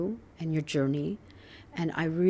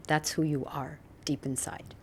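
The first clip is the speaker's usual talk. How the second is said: whispering; 4 semitones lower, 6 dB quieter than usual. Real, not acted. Tender reassurance to guest.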